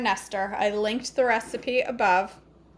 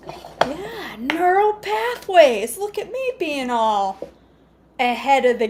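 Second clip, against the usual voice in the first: hammed-up pride; 4 semitones higher, 5 dB louder, slower than usual. Proud about brain exercise.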